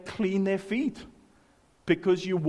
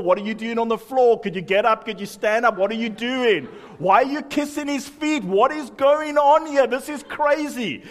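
mock outrage; 4 semitones higher, 6 dB louder than usual. Mock churchgoer rising in outrage.

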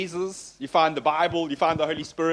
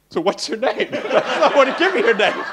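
laughing retelling; 8 semitones higher, 6 dB louder than usual. Retold or imitated. Retelling the forgotten name.

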